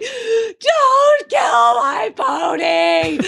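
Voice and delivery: high, slow mock scream; hammed-up wail begging daddy; melodramatic little girl. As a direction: shriek it out like a hysterical child, imitated. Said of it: "as bad as you can imagine".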